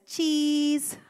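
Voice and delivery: put-on high, bright kid's "Cheese"; lightly voiced little girl saying cheese. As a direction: say it like a small girl posing for a photo. Daughter posing for x-ray.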